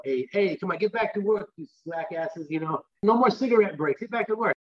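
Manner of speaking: hammed-up New York accent, hustling the crew; mock New York boss telling slackers to get back to work. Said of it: "really New York guy".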